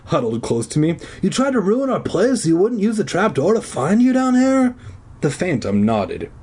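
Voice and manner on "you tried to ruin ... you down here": high, mounting accusing question; demanding. A narrator's reading. Zeke accusing the Phantom of ruining the play, demanding. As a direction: press the accusation hard. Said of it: "Zeke demanded".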